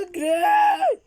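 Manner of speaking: shrieking high and loud in fright; real panicked shriek to run; terrified scream. Real, not acted. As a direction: scream it, scared out of your wits. Jump scare, screams to run.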